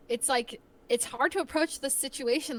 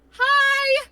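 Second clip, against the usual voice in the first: high, loud squeaked hello; mock-nervous greeting that shoots way up; 12 dB louder than usual. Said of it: "like, I get nervous".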